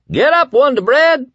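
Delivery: very high, loud taunting call; gleeful call. A narrator's reading. Guard calls in to a prisoner's cell, gleeful. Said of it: "a gleeful voice called in".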